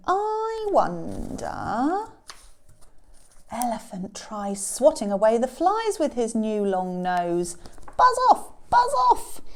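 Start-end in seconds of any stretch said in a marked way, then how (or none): 0.1-2.1 very slow, high pondering; musing. Acted. Elephant pondering his new nose, slow musing. 8.0-9.4 high, loud, short bursts; shooing shout. Acted. Elephant swatting flies with his new nose, shouting.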